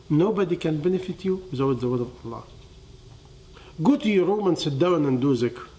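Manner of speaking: ordinary delivery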